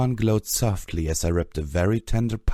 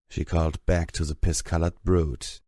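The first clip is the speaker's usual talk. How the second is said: usual speaking voice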